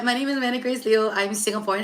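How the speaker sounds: ordinary talk, nothing marked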